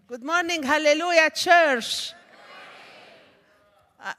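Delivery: ordinary talk, nothing marked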